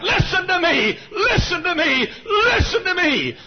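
very high, drawn-out pleading shout; crying out. Retold or imitated. Preacher re-enacting three years of prayer, crying out. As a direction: wail each plea up to God. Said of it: "I cried".

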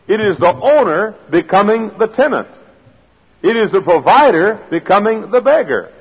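high-pitched, rhythmic pulpit cadence; emphatic preacher building through parallel phrases; preaching. Real, not acted. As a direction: proclaim each line with rising fervour.

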